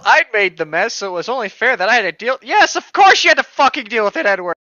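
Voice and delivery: ordinary delivery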